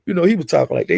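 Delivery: normal talking voice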